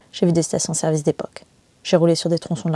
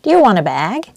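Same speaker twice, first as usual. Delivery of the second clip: higher, louder, bright customer-service English; visitor center lady; 4 semitones higher, 8 dB louder, slower than usual. Retold or imitated. Visitor center lady offering the narrator a bag, chirpy clerk voice. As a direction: chirp it like an eager shop clerk. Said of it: "La dame m'a demandé".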